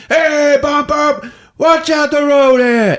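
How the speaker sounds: high, strained shouting at a pedestrian; angry driver yell. Acted. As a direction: holler it as a hammed-up irate driver.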